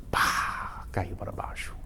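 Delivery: sussurrado, suspense; sussurro dramático. Acted. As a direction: sussurre a queda do telhado. Telhado cai de repente. (narrador conta o telhado caindo, sussurrando)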